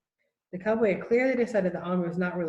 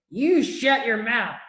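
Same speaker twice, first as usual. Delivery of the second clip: slow, loud snarl, pitched up; angry shout; 4 semitones higher, 6 dB louder, much slower than usual. A narrator's reading. Tiny cowboy shouting down at Omri, furious. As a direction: spit each word at the boy. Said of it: "shouted the little man".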